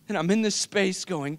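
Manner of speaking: ordinary delivery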